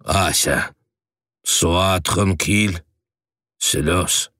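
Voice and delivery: calm her gently; soothing. A narrator's reading. Governess soothes Asya.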